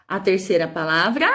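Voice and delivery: ordinary talk, nothing marked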